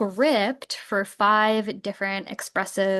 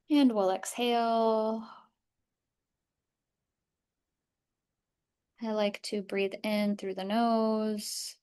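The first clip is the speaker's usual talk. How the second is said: softer, even voice easing listeners through the body; guided body scan; 6 dB quieter than usual. Acted. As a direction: lead a calm breathing and body-awareness practice. Leads a body scan meditation.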